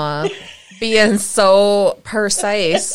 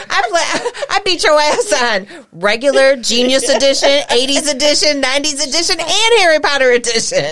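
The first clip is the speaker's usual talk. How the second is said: high-pitched mock boast; cocky trash talk, rising in pitch; imagined daughter bragging; 5 semitones higher, faster than usual. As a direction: rattle off the list like victories. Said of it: "She's like".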